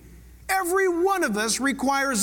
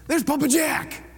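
lightly voiced parent; 3 semitones higher than usual. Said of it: "[name] will tell Isaiah".

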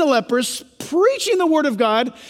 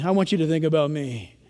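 lower, softer, wheedling; lightly voiced disciple angling for attention; a hint of a whiny self-seeker; self-centered plea; 11 semitones lower, 5 dB quieter than usual. Disciple pleading.

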